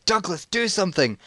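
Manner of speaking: high, urgent mum voice; mock panicked mum. Mum appealing to dad, high mock urgency. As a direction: plead high, like an exasperated mum. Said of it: "as if to say".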